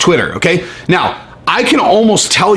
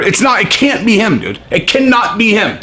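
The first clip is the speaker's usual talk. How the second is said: ordinary talk, nothing marked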